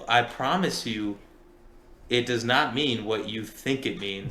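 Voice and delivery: slow, deliberate assurance; slow mock-serious warning; slow emphatic warning. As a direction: spell it out slowly. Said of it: "we all looked at him like".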